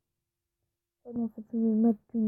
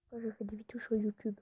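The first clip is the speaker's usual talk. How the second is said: YouTube whisper; 6 dB quieter than usual. Shown as a demonstration. Vlogger showing viewers her YouTube voice, whispering. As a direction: whisper it, no voice at all. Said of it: "En gros, je parle comme ça".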